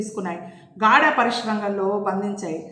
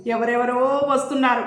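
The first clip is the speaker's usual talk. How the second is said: ordinary delivery, nothing marked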